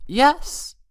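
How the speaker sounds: sudden squeaky high yelp; cried out too high. A narrator's reading. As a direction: blurt it out a pitch too high. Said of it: "she cried, a pitch too high".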